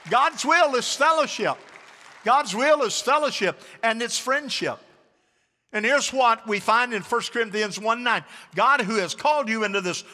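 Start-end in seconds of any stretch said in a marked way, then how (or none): 0.1-7.8 loud, high-pitched sermon delivery; raised preacher's voice; preaching at full force. Real, not acted.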